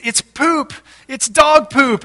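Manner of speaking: high-pitched retold alarm; alarmed announcement. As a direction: imitate the wife's startled discovery. Voicing wife's alarmed discovery.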